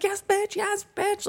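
very high celebratory squeal; acted elation. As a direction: squeal it like a hammed-up cheer. Acting overjoyed reaction to find.

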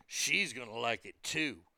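a sly aside, low and quiet; low suggestive murmur. Retold or imitated. Frank Thomas to the man about his wife, low sly murmur. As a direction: drop low and soft, knowing and sly. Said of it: "Frank looks at her and goes".

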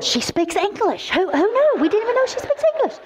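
put-on high, fast excited girls' chatter; excited girls. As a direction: squeal it like amazed teenage girls. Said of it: "The two girls on the front row were going".

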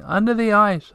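high echo, still near and full; the echo bounces the words back; echo crying out. A narrator's reading. The echo repeats Tutiki, high and near.

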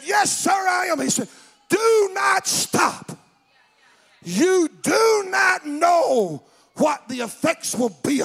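slow, loud preaching cry, pitched high; impassioned retold exhortation. Stranger urging the young man on, drawn-out fervent shouting. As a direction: hammer out each word with urgency.

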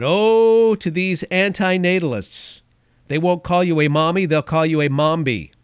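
mocking. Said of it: "mocking the antinatalists".

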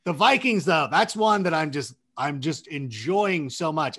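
usual speaking voice

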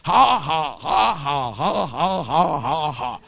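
deliberate, humourless spoken ha-ha; dry forced laugh. Retold or imitated. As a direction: fake a laugh as dry as it gets. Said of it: "Just as dry as, about as that".

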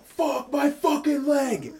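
high, drawn-out mock yell of pain; muted howl of an injured guy; injured man's cry. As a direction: wail it in a strained high voice, held back in volume. Acting out injured crash passenger's cry.

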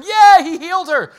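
high, loud excited cheer; mock crowd cheering. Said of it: "everyone's, like, cheering".

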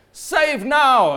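a festival shout to the crowd; priests crying out. Retold or imitated. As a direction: call it out like priests at the feast. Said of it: "I imagine the priests are saying".

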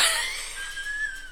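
high laugh. Real, not acted.

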